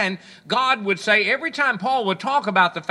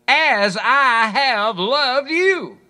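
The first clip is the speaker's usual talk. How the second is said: each word drawn out, slow and louder; slow emphasis; 4 semitones higher, 6 dB louder, much slower than usual. Shown as a demonstration. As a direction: lean hard on every word, very slowly. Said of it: "Listen to it".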